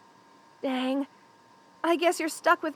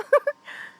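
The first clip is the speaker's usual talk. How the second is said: high, short giggle; relieved laugh; 12 semitones higher, 4 dB louder than usual. Acted. Joking to a friend about a freezing room, relieved laugh. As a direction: laugh with relief after the cold.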